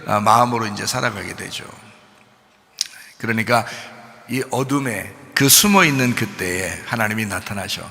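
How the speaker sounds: ordinary talk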